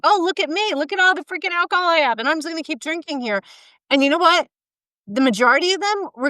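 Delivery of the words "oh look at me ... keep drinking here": high, quick mocking brag; mock Facebook show-off. People posting lockdown drinking on Facebook, high mocking brag. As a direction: imitate a drinker boasting online, pitched up and hurried. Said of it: "posting on Facebook".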